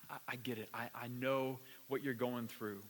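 soft, low and gentle; tender reassurance. Acted. Jesus with an arm around the hurting, tender and hushed. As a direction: quietly comfort someone who is hurting.

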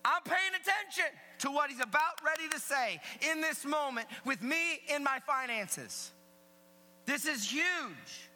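high, loud, driving sermon delivery; full-force preaching. Acted. Preacher relaying Jesus's warning to the congregation, emphatic shout.

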